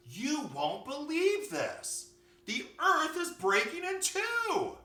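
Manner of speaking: very high, loud, stretched-out wail of alarm; frantic little bunny, slow and high; panicked bunny. A narrator's reading. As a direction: cry out that disaster is coming.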